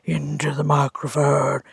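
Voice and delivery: hoarse from a cold; rough, sick-sounding throat; husky sick voice. Real, not acted. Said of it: "my sexy husky voice".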